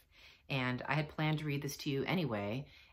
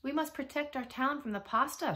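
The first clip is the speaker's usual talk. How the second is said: high, raised story-time shout; mayor's shout; 11 semitones higher, 4 dB louder than usual. A narrator's reading. Mayor rallying townsfolk against the pasta, shouting. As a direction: call out like an alarmed mayor. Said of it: "shouted the mayor".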